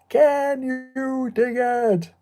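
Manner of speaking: long drawn-out, high rallying cry; slow, loud, high movie-quote holler; rallying movie shout. Acted. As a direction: call it out to a crowd, stretching every word. Famous shouted movie rallying call.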